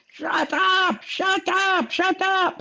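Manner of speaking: high, mock Arnold barking shut up three times; Schwarzenegger impression. Imitating Arnold telling kindergartners to shut up. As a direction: do the Arnold kindergarten-cop line. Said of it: "Arnold Schwarzenegger goes".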